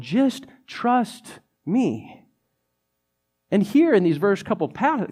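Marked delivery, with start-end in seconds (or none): 0.0-2.3 tender invitation. Retold or imitated. Jesus inviting disciples to trust.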